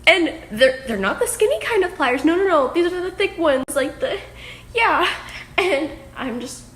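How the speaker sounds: loud, high, disbelieving rush; bursting with shocked laughter; excited. Real, not acted. Shocked by pliers and racket.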